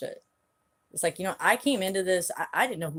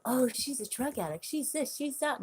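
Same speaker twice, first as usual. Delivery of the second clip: mocking gossip voice; 7 semitones higher, 5 dB quieter than usual. Mocking what people say about her.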